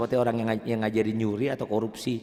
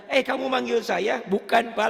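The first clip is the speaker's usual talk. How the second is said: much higher, comic questioning voice; high-pitched mock dialogue; acted passer-by exchange; 12 semitones higher than usual. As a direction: play a puzzled passer-by and the reply.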